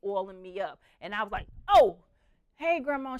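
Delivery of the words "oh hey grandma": startled greeting. Retold or imitated. Woken startled at 2:30.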